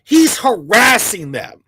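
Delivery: ordinary delivery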